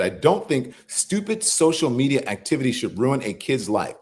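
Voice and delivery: ordinary talk, nothing marked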